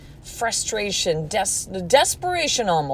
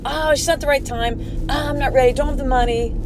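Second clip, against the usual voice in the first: higher, whiny run of excuses; mimicking excuses; 5 semitones higher than usual. Mimicking clients who back out of her offers, higher and whiny. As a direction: toss off each excuse like a flimsy dodge. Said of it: "And then you go".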